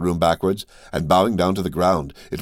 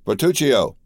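raised call across the house, pitch up; 6 semitones higher, slower than usual. A narrator's reading. Count summons his steward, calling out. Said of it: "cried he, striking a light hammer".

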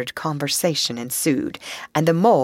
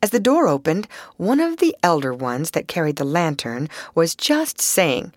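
ordinary delivery

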